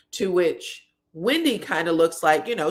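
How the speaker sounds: ordinary talk, nothing marked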